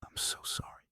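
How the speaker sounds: tearful, breathless whisper; choked whisper. A narrator's reading. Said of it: "she choked out".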